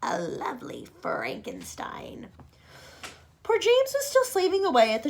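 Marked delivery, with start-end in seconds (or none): 0.0-2.3 much lower, softer, slow whispered insult; sly whispered jab. Acted. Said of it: "Aunt Spiker said".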